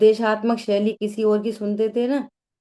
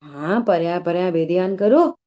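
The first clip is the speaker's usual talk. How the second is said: louder affirming instruction, rising at the end; retold teacher's reply; 3 semitones lower, 5 dB louder than usual. Pandit sahab replying to his questioner, retold emphatic instruction. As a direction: answer firmly, lifting the last word. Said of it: "तो पंडित साहब बोले कि".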